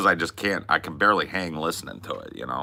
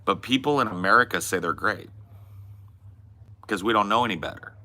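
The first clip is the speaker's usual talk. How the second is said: ordinary delivery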